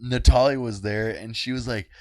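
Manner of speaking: usual speaking voice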